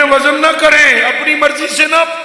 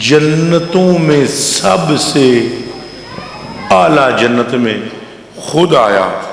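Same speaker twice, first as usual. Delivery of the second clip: low, slow, weighty delivery with long pauses; solemn preaching; 8 semitones lower, slower than usual. Acted. Preacher recounts God coming in highest paradise, solemn low preaching. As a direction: let each phrase sink in, deep and measured.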